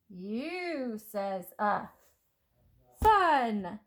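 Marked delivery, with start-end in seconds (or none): 0.1-1.9 very slow U says uh; slow phonics drill. Shown as a demonstration. Teacher modelling the vowel to children, slowly. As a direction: stretch it out for young readers. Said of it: "The sound is uh".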